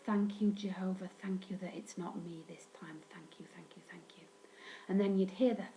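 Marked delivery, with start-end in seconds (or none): 0.0-4.2 grateful murmur fading down to a whisper; silent prayer of thanks. Retold or imitated. A relieved kid silently thanking Jehovah in a whisper. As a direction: pray quickly under the breath, trailing off to nothing. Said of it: "give a silent prayer of thanks".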